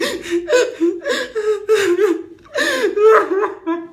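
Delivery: laughing sob. Retold or imitated. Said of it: "I was crying".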